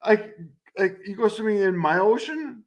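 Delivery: ordinary talk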